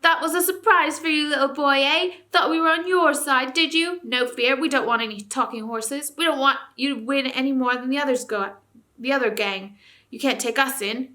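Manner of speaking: high, loud mocking dwarf voice; jeering dwarf. A narrator's reading. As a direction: dwarves taunt the boy. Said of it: "the dwarfs jeered back at Eustace".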